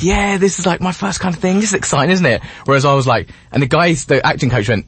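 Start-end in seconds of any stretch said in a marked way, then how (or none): 0.0-2.4 lightly voiced eager novice, pitched higher; innocent eighteen-year-old. Young actor on his first scene, lightly mimicked innocence. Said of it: "he was so innocent".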